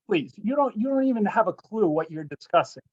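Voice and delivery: usual speaking voice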